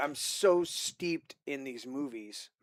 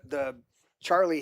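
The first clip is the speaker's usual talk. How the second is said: usual speaking voice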